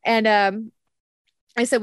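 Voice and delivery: normal talking voice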